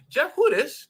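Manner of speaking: high, loud confronting question; calling him out. Acted. Accusers confront Jeff with pictures.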